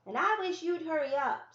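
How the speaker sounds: put-on high, loud impatient child's call; calling from down the path. Impatient brother calls up the path to Ellie, high and loud. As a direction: shout it to her from further down the trail. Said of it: "Samuel said from down the path".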